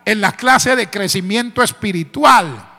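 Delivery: full-force preaching. Real, not acted. Preacher urging new brethren to seek God, full force. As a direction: build it up to a ringing, high-pitched peak.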